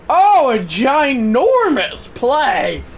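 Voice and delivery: loud, stretched-out amazement; excited exclamation. Real, not acted. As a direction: draw it out, thrilled by the play. Exclaiming at a big play.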